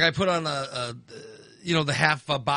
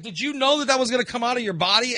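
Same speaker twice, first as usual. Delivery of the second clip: fast incredulous question; 6 semitones higher, faster than usual. Retold or imitated. Retold confrontation.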